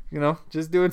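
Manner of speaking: normal talking voice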